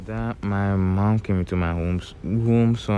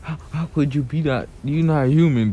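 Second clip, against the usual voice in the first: shocked; 6 semitones higher than usual. Retold or imitated. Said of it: "Sasuke's like".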